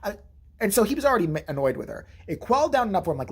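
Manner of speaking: usual speaking voice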